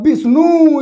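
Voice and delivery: Vishnu with the final नू drawn out; stress on नू. Shown as a demonstration. Teacher contrasting weak and strong syllables, stress on नू of Vishnu. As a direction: push the end of Vishnu. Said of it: "इस पर ज़्यादा बल लग रहा है".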